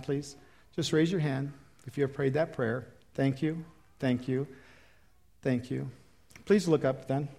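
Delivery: tender altar call voice; hushed altar call. Acted.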